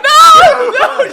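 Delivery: high, loud screaming no; shocked scream. Retold or imitated. Housemate hearing the news, screaming in shock. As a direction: shriek no in horror.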